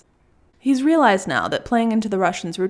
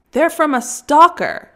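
higher and louder, slow and cutting; sharp retort; 7 semitones higher, 6 dB louder, slower than usual. Acted. Russian woman snapping that the flowers come from a stalker. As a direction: shut down the idea.

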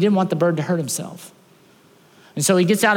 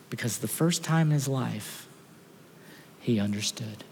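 slow, much softer heartfelt telling; softer, slow, tender preaching; tender sermon close; 4 semitones lower, 6 dB quieter than usual. Acted. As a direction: draw them in gently, slowly. Sermon climax, slow and soft.

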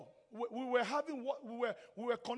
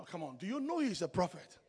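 normal talking voice

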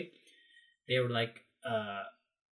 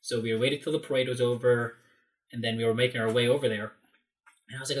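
normal talking voice

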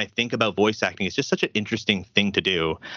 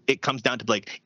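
ordinary delivery

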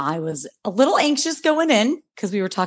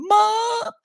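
put-on child calling mom. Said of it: "Jackson will come tearing up here ... going".

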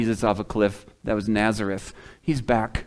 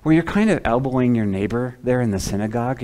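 normal talking voice

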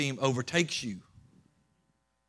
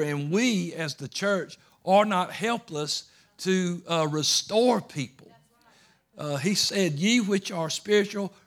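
louder, higher preaching, driving each line; rally the church from the pulpit; preaching at full force; 4 semitones higher, 6 dB louder than usual. Acted.

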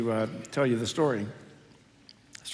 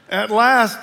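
exclaimed; 7 semitones higher, 9 dB louder than usual. Acted. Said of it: "the man exclaimed".

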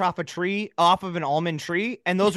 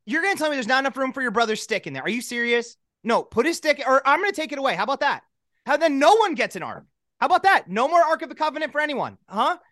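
higher, exasperated mom scolding; 6 semitones higher than usual. Acted. Mom scolds Moses over the brother's stick, exasperated and higher. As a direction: lay down the law like a fed-up parent.

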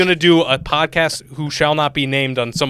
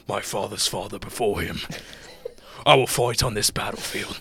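Thor; 4 semitones lower, 6 dB quieter than usual. Acted. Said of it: "that's the more Thor one".

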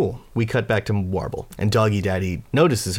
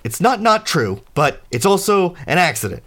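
high, louder, stammering defense; flustered excuse; 8 semitones higher, 4 dB louder than usual. Acted. Fads answers the accusation of killing, flustered excuse. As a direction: plead your case haltingly, pitch raised.

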